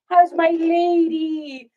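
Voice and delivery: slow, high cooing to the dog. Real, not acted. Asking her dog how she is, slow high coo. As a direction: stretch it out sweetly for the pup.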